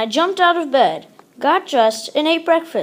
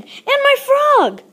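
high, slow joyful lift; delighted reveal; 13 semitones higher, 4 dB louder, slower than usual. A narrator's reading. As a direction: savour the happy surprise. Frog returns, joyful climax.

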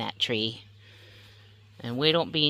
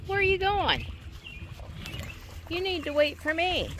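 much higher, sing-song call; calling after the dog; 14 semitones higher, 4 dB quieter than usual. Real, not acted. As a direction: call the runaway dog back up high. Dog running off.

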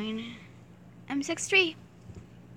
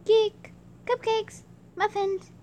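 much higher, louder, slow and drawn out; gushing; 10 semitones higher, 6 dB louder, slower than usual. Acted. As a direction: savour each treat. Drawn out excited choice.

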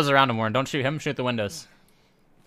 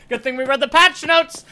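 higher, louder sarcastic repeat; loud sarcastic yell; 12 semitones higher, 9 dB louder than usual. Real, not acted. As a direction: shout the line back sarcastically. Louder, higher second take.

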